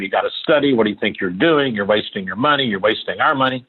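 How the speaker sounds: ordinary talk, nothing marked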